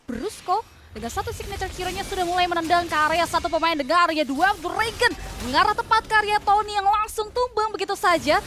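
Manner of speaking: loud, fast esports call; excited play-by-play. Real, not acted. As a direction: shout the teamfight as it happens. Live play-by-play of teamfight. (komentar heboh)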